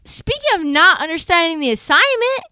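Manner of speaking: high-pitched, loud, salty rant; mock-scold him for stealing the assist; salty Draymond. Teammate scolding Wiggins over the stolen assist, salty and loud. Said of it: "Draymond Green, salty".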